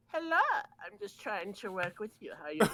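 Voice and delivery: butter them up in a put-on sweet voice; Jose voice. A guy who wants to work with you, imitated in a persuasive voice. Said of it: "the Jose voice".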